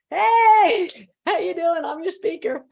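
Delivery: loud, high-pitched "hey" easing off; sheepish cheery greeting. Retold or imitated. Caught pumping up by conference attendees, sheepish cheery greeting. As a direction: call out a big hello, then settle. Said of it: "and I'm like".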